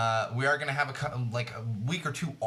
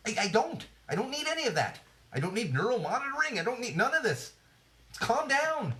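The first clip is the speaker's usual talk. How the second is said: much higher, squeaky panicked no; mock freaked-out refusal; 11 semitones higher than usual. Answering a society that says you need everything, high panicked refusal. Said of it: "It's like".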